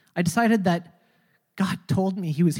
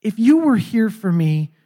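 loud, slow defiant demand; loud dare to God; challenge to God; 4 semitones higher, 8 dB louder than usual. Retold or imitated. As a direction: throw down the dare. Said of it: "I just said".

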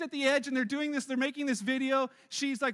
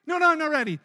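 loud, higher, hurried refusal; mock panicked protest; 4 semitones higher, 10 dB louder, faster than usual. Girlfriend stopping the countdown, loud mock panic. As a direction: cut in with imitated panic.

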